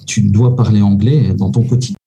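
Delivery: ordinary talk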